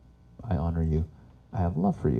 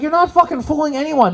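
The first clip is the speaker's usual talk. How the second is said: much higher and louder, fed up; exasperated shout; 6 dB louder than usual. Retold or imitated. Son yelling at his mother in Russia, exasperated. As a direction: yell it in disbelief.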